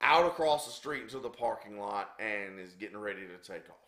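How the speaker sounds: ordinary delivery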